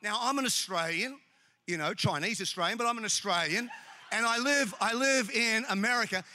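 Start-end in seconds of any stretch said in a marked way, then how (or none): none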